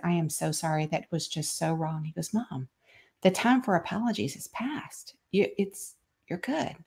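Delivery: soft, tender and quiet; hushed exchange. Retold or imitated. Mother and son reconciling, hushed and soft. Apology and son's gentle reply.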